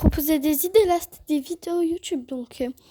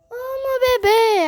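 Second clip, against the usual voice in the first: kitten voice; 8 semitones higher, 4 dB louder, slower than usual. Real, not acted. Cooing at her cat.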